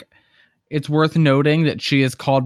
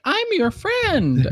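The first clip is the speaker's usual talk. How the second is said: high, drawn-out singsong luring; mock creature coaxing, stretched out; tricky fake friendliness; much slower than usual. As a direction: lure them in with a high, slow fake-sweet voice. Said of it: "like, tricky about it".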